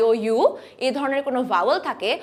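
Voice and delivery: ordinary talk, nothing marked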